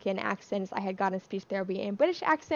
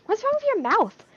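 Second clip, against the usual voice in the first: high-pitched retold alarm; mock alarm; 12 semitones higher, 4 dB louder than usual. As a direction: imitate a startled husband, pitch jumping. Retold husband alarmed at her mouth.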